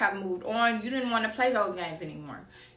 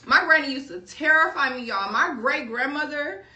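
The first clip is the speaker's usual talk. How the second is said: normal talking voice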